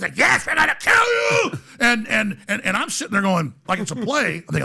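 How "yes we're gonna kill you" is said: very high, drawn-out shouted threat; hammed-up ritual shriek; menacing executioner. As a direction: scream it as a mock cult threat. Acted executioners answering the victim.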